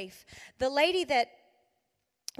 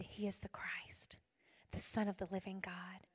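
reverent whisper; 5 semitones lower, 12 dB quieter than usual. Acted. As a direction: whisper it like a sacred secret. Peter confesses Jesus, reverent.

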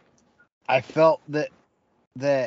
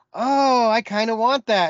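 put-on bright, eager girl's voice; higher and louder, eagerly wanting it; 9 semitones higher, 6 dB louder than usual. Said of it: "she was like".

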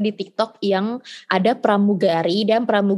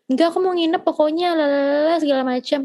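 higher, whiny defiant refusal; stubborn kid refusing; 6 semitones higher than usual. Retold or imitated. Daughter defying mom, whiny high refusal. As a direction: dig in like a stubborn child. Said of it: "anak yang membangkang dan batu".

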